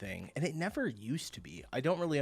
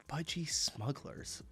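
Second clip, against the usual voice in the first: laughing whisper; 3 semitones higher, 5 dB quieter, slower than usual. Real, not acted. Laughing over the slang term.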